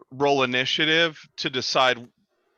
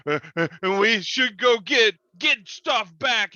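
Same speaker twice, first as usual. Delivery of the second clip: high, loud frantic bugbear voice; a scared monster blurting out excuses; shrill-edged panic, pleading and shouting; panicked bugbear; 7 semitones higher, 4 dB louder than usual. Acted.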